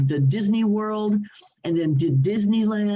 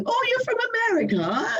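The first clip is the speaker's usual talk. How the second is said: high, fast, put-on customer voice; mock English customer; faster than usual. Shop customers quizzing the American girl, mock English voice. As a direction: chirp it like a curious local shopkeeper.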